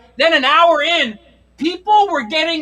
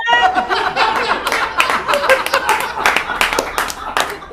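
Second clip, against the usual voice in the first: airy laugh; 5 semitones higher than usual. Real, not acted. Laughing after the seniors bit.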